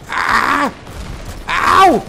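high, sharp cries of ow; loud, high startled ow; pained yelps. Real, not acted. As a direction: yelp as if hit. Being shelled in game.

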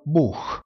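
Buch con CH aspirato e marcato; Buch, CH raschiato forte in gola; CH enfatizzato. Shown as a demonstration. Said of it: "io la enfatizzo di molto". (Buch con CH gutturale esagerato)

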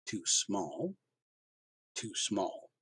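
quiet, puzzled repeat of "Too small"; turning the words over in disbelief; disbelieving repeat. Acted. Father to son, quietly repeating his words in disbelief. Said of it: "He rolled the words around".

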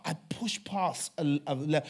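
ordinary talk, nothing marked

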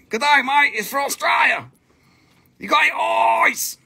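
high-pitched, hammed-up Aussie accent; bad Australian accent. Seller telling buyers it's from Australia, bad Australian accent. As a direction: do a badly faked broad Australian. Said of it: "that's all my really bad Australian shit".

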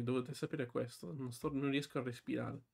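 usual speaking voice

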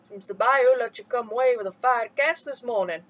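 put-on Elder Abbajohn teasing the boy kindly; old man's voice. A narrator's reading. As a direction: speak as a wise old man teasing.

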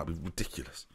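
ordinary delivery

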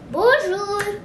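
high drawn-out hello; sing-song greeting. Shown as a demonstration. Quiz answer for greeting a teacher, sing-song.

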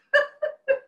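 a short bright burst of laughter; high delighted laugh. Real, not acted. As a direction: laugh out loud at the punchline. Laughing after the story.

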